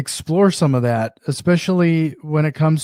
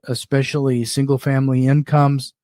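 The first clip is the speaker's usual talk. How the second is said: ordinary talk, nothing marked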